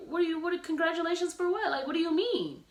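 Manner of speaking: puzzled mock outrage; incredulous asking; baffled. Confused by congratulations messages.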